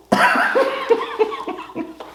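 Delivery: shrieking high laugh; high cackling laugh. Real, not acted. Comedian cackles at his own misreading.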